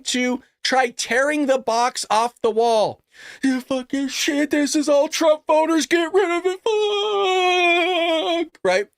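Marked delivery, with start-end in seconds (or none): 3.4-8.6 high, strained ranting imitation; mock freak-out. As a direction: climb higher into a hammed-up long wail. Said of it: "Just freaking out 'cause they're spastic".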